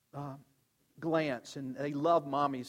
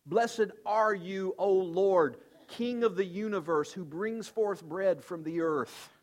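slow, louder, raised solemn blessing; solemn prayer; 4 semitones higher, 4 dB louder, slower than usual. Retold or imitated. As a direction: proclaim it reverently, God high and lifted up. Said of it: "which means".

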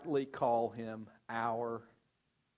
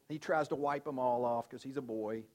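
usual speaking voice

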